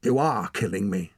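mildly snapped retort; snappish. A narrator's reading. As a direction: snap back with a touch of irritation. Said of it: "she snapped".

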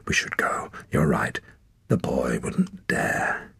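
low whisper, slowing at the end; muttered whisper. A narrator's reading. Woman urging her companion away, murmured. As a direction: keep murmuring, eager to leave. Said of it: "muttered the woman".

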